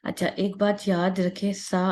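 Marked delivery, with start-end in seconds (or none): none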